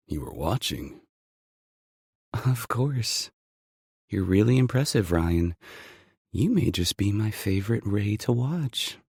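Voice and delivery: flirty heroine, light and teasing; woman's voice. Acted. Female lead voiced by narrator.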